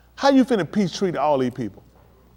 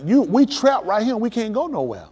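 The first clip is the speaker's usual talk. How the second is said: people complaining to their leader; 7 semitones higher than usual. Retold or imitated. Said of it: "They like".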